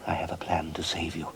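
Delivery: secretive whisper. Acted. Father to son, a secretive whisper.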